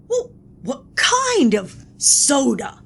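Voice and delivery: ordinary talk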